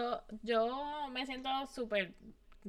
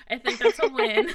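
laughing; 5 semitones higher, 7 dB louder than usual. Real, not acted. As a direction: laugh it out as a win. Joke about a friend's half understanding.

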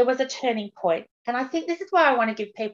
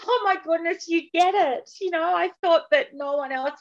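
high, excited gasp of recognition; a new member's delighted relief at finally being understood; relieved delight; 8 semitones higher than usual. Retold or imitated. Forum member to host, high delighted relief. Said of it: "it's like".

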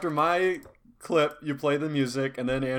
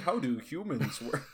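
softer, put-on psychopath musing; mock psychopath; 8 dB quieter than usual. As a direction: lightly play a puzzled serial killer. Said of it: "some psychopath".

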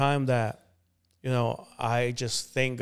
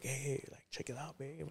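hushed; 17 dB quieter than usual. Retold or imitated. Showing his girlfriend the gun, softly hushed. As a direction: keep it down, showing off a secret.

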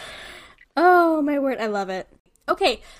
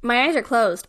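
usual speaking voice

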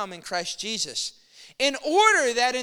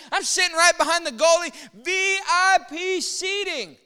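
louder, higher, thrilled bragging; excited retelling; 4 semitones higher, 5 dB louder than usual. Retold or imitated. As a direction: gush about the great seats. Retelling excited talk to friend.

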